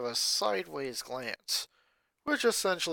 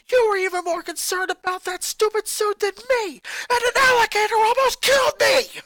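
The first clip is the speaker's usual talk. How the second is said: put-on high, loud furious boy shouting at family; a boy exploding at his mother; angry boy ranting; 11 dB louder than usual. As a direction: snap back at Mom, furious. Lincoln accuses father.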